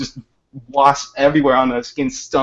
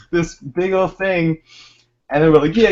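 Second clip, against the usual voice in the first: high, loud chanting egg-on; rally the crowd; cheering kids; 8 semitones higher, 6 dB louder than usual. Retold or imitated. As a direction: chant it like a gang of excited kids. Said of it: "everyone's else like".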